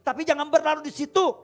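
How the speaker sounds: loud, high exhortation; full-force preaching. Real, not acted. Preacher urging the church to trust God, at full force. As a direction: preach it hard to the congregation.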